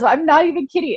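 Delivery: usual speaking voice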